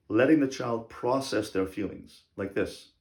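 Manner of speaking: ordinary talk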